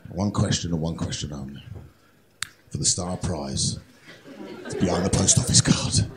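hushed, low game-show host; suspenseful host. Acted. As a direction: build suspense softly before the star prize. Host builds suspense for star prize.

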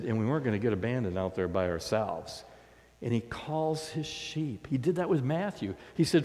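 normal talking voice

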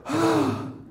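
short heavy huff of breath; dog-like sigh. Retold or imitated. Agent sounding like the speaker's dog, heavy sigh. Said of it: "he'll sound like my dog".

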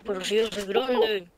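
low fake grown-up voice; put-on deep voice. Boy insisting he is big, put-on deep voice. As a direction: drop the pitch to pretend you're older. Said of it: "Dejá de hacer la voz".

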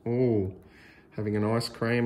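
usual speaking voice

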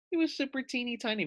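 tiny voice. Shown as a demonstration. Teacher tells first graders the lizard was tiny, in a high squeak. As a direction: squeak it small.

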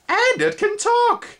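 insect surprise. A narrator's reading. Spindlebug hearing Twig speak, high astonished voice. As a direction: gasp that it talks. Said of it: "another in surprise".

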